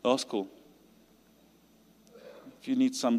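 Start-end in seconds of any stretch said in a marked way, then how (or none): none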